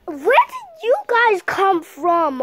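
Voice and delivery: slow, startled voice; surprised character voice. Acted. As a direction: act surprised at newcomers.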